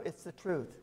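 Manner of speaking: ordinary talk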